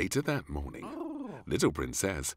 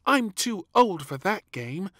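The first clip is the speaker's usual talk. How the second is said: put-on high, indignant child; frowning little princess; 8 dB louder than usual. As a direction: scorn the baby game. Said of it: "said little Princess with a frown".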